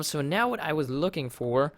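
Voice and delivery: ordinary talk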